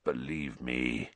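low, slow croak. A narrator's reading. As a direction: croak it out, pleading.

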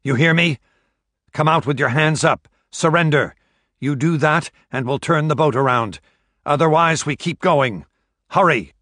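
loud, commanding call across a boat. A narrator's reading. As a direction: shout the order out across the water. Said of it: "it called out".

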